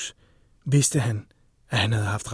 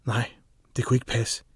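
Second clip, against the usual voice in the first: ordinary delivery, nothing marked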